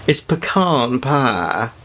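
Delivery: slow Deep South drawl, pecan pie as puh-KAHN pah; Deep South pronunciation. Shown as a demonstration. Showing how Deep South folks say it, drawn-out Southern puh-KAHN pah. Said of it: "if you're from the Deep South".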